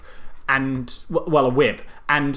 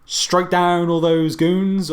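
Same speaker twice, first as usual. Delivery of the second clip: raised pitch, incredulous appeal; sarcastic heckling; 5 semitones higher than usual. Pretend. Podcaster addressing Raiden about the goons, sarcastic heckling. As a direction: call out the obvious as if to a dim hero.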